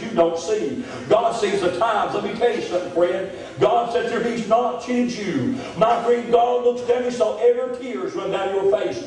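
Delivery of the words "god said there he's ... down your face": high, rising preacher climax; full-force preaching. Acted. Preacher closing the sermon to the congregation, building fervently. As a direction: build to a pulpit peak.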